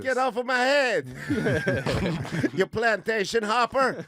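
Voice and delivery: put-on Jamaican accent. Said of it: "Was that a Jamaican accent?".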